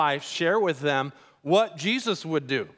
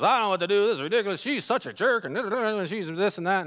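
mildly exasperated, higher-pitched caller; frustrated venting; 4 semitones higher than usual. Retold or imitated. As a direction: imitate a man venting about his ex. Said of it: "he called me up frustrated".

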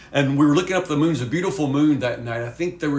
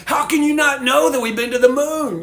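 incredulous and much higher, louder; mocking disbelief; 9 semitones higher, 4 dB louder than usual. Teen ridiculing a friend about the moon, loud mock disbelief. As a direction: pile on the friend, amazed he doesn't know. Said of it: "they just dog him".

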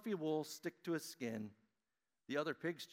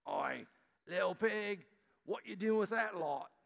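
put-on higher, louder London accent taunt; 7 semitones higher, 6 dB louder than usual. Pig friends jeer at little sheep, London accent. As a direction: call out like London pigs. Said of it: "they had strange accents".

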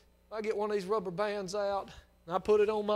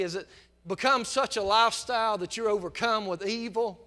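fervent preaching; 2 semitones higher, 7 dB louder than usual. Real, not acted. Preacher pleading with the lost, loud and fervent. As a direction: press the sinner hard from the pulpit.

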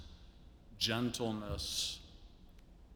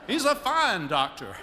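high, louder genteel voice, slowing at the end; imitating Gwen; 13 semitones higher, 6 dB louder than usual. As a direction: deliver the punchline in her high refined voice. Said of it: "I can't do her elegant accent".

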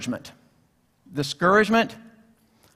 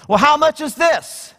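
raised voice, a lightly voiced bargain hunter; curious customer; 6 semitones higher than usual. Man at Satan's garage sale asks about an item, lightly voiced. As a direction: ask it like a browsing shopper, pitched up. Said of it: "He goes".

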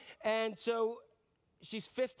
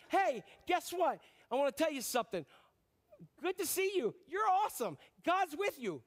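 much higher, bubbly good-news voice; hammed-up cheerful angel; 5 semitones higher than usual. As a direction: deliver it like an excited messenger. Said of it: "there's a future reference".

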